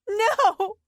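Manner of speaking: high, loud shout. Acted. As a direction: Siobhan refusing Dorothy's comfort, a high shout. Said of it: "shouted Siobhan".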